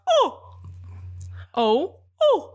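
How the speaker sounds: high, rounded British "oh"; mock British accent. Shown as a demonstration. Explaining British mouth shape to co-host, mock British accent. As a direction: show off a British "oh". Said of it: "you gotta do this with your mouth".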